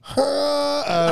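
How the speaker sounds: high wailing wookiee cry; Chewbacca howl. Acted. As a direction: wail like a wookiee. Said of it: "That sounded like your girlfriend".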